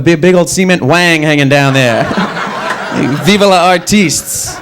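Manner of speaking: usual speaking voice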